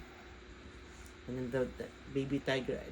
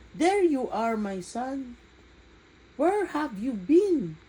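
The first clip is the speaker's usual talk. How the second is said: relieved call; 9 semitones higher, 9 dB louder, slower than usual. Acted. Father finds son.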